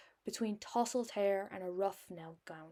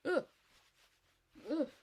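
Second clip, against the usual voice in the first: disgusted groan; 6 semitones higher than usual. Real, not acted. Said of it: "there was a spider".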